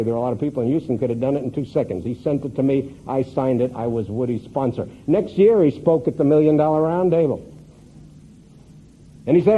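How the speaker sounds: ordinary talk, nothing marked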